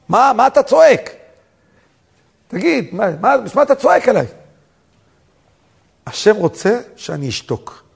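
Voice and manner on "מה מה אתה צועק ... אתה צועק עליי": indignant retort. Retold or imitated. Humiliated young man demanding why he is yelled at, indignant high protest. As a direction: push back with raised voice.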